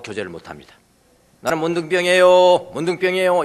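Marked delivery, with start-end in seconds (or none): 1.4-3.4 leper's call. Retold or imitated. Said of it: "이렇게, 이렇게 하고 돌아다녀야 돼요".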